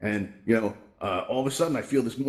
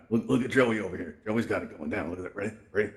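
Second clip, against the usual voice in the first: ordinary talk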